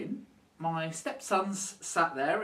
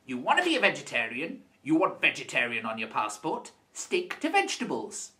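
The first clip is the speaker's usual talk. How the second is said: usual speaking voice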